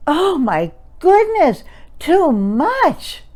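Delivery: high, loud mock alarm for a baby; playful surprise. Shown as a demonstration. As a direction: ham up the shock over the spoon. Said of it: "you might remark".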